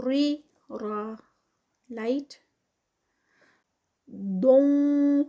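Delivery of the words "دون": very slow chanted دون; Quran recitation. Shown as a demonstration. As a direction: recite long and held. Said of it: "دود heavy ہوگا".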